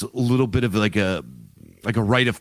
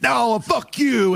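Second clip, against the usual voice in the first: shouted high and slow, hammed-up; mock yell; 11 semitones higher, slower than usual. Boss lady yelling back at Tony in a mock shout. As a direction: yell it right back at him. Acted quarrel, she yells back.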